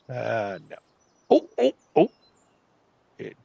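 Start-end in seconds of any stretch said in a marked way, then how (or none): 1.3-2.1 sharp high startled oh oh oh; surprised. Real, not acted. Player startled as the tool overshoots, loud and high. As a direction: yelp as it jumps. Tool does something unexpected.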